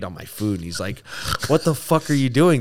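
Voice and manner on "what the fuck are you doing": mock outraged challenge, louder and higher; a touch of angry disbelief; retold indignation. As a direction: imitate an interviewer's affronted outburst. Interviewer's outrage, acted in retelling.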